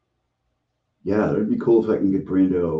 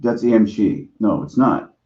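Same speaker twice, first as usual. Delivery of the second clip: ordinary talk, nothing marked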